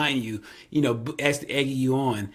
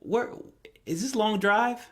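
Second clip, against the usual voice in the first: amazed disbelief; 8 semitones higher than usual. Retold or imitated. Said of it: "I'm like".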